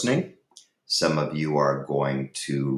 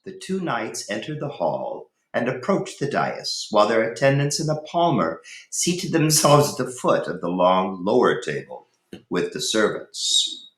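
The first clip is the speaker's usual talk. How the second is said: lively read-aloud voice, higher and animated; story narration; 7 semitones higher than usual. Acted. Teacher reads Ivanhoe chapter two to students, storytelling.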